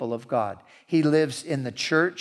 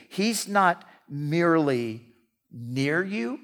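ordinary talk

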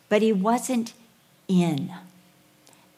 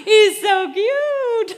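high, loud, slow, laughing; laughing gush; 13 semitones higher, 8 dB louder, much slower than usual. Retold or imitated. Mother at the party, laughing high and loud. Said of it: "I'm like".

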